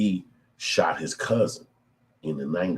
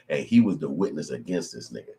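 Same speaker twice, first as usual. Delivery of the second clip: ordinary talk, nothing marked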